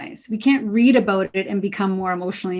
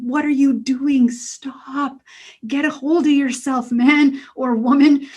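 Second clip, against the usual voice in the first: a lightly voiced higher inner scold; 4 semitones higher than usual. Speaker voicing the inner voice telling herself to stop, a lightly raised scold. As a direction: gently scold yourself like a conscience. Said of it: "that little whisper of a voice going".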